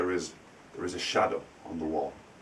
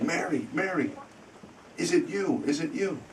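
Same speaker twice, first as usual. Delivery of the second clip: raised, questioning call to Mary; uncle calling, higher; 9 semitones higher, 3 dB quieter than usual. Retold or imitated. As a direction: call out to her higher, unsure.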